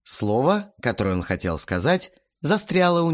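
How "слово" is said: ordinary delivery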